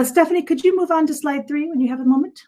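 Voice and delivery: ordinary delivery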